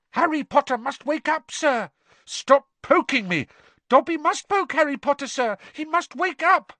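high squeaky elf; house elf voice. Acted. Dobby voiced by narrator.

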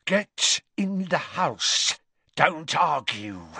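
father ordering his son in Parseltongue; hissed Parseltongue order. A narrator's reading. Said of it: "out of the corner of his mouth".

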